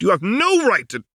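high, loud angry rant; rising rant. Acted. Lux ranting at Echo before Jinx stops her, loud and high. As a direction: rant at him until cut off.

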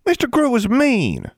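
put-on high, loud childish protest; slow, indignant little-girl complaint; angry. A narrator's reading. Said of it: "Innocence said angrily".

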